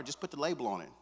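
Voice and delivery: ordinary talk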